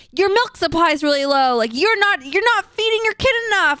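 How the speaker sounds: high, lecturing mock voice of parenting websites; mocking preachy websites. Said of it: "a lot of them are like".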